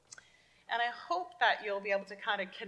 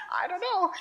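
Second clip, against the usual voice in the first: laughing through the question; 10 semitones higher, 3 dB louder than usual. Real, not acted. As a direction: giggle while asking. Laughs at child's answer.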